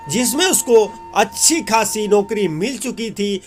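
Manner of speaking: ordinary delivery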